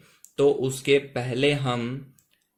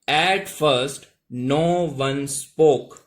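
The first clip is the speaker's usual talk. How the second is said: slow model reading; much slower than usual. Shown as a demonstration. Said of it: "ठीक है दोस्तों".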